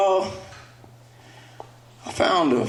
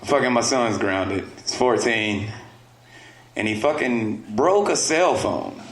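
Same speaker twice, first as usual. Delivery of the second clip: ordinary delivery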